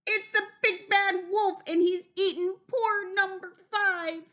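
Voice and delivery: high, loud duck squawk of alarm; high, outraged duck voice; frantic duck cry. A narrator's reading. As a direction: cry out accusingly as an enraged duck. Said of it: "she cried".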